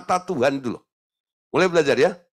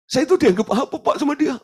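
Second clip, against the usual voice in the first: high, louder plea of a weeping wife; mock tearful wife; 11 semitones higher, 4 dB louder than usual. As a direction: whine to the counselor in mock tears. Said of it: "Mewek di depan saya gitu". (istri mengadu sambil mewek, nada tinggi)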